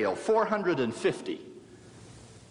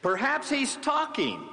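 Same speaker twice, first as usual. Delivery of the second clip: higher, slow, sarcastic; mock suggestion; 11 semitones higher, slower than usual. Elijah ridiculing the silent Baal, slow mock sympathy. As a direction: feign concern for their god. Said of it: "Elijah mocked them".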